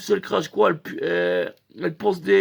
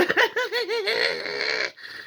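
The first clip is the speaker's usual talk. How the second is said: rire d'ivrogne aigu; 13 semitones higher than usual. Retold or imitated. Rire du tatoueur ivre.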